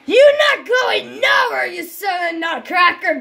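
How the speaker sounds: furious doll threat; threatening shout. Pretend. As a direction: yell after the fleeing enemy. Threatening chase.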